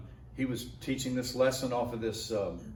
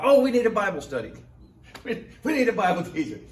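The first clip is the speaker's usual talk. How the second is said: higher, louder delighted burst; eager pastor; 8 semitones higher, 6 dB louder, faster than usual. Retold or imitated. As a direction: grab the newcomer, thrilled.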